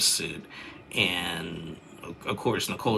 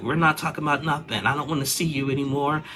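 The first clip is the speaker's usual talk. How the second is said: retold angry refusal; 7 semitones higher, 3 dB louder, faster than usual. Wife refusing husband in fight.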